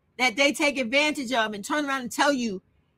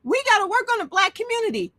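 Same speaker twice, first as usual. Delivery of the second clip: higher, quicker mocking voice; mock pleading lines, pitched up and hurried; mimicking men's talk; 8 semitones higher, faster than usual. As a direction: parrot their slogans in a raised pitch. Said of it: "they talk about".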